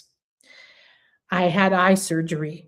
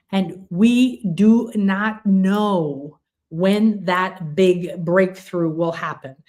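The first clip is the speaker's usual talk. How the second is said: ordinary delivery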